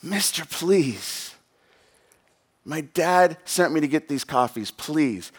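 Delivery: put-on soft, slow plea from a young boy; lightly voiced pleading child; pleading boy's voice. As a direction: plead quietly, as a hurt kid to a stranger. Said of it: "I hear his voice".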